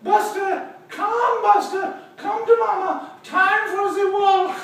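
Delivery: very high cooing lady's voice; owner calling her dog. Acted. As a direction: call the pet in a high sing-out. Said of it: "And she said".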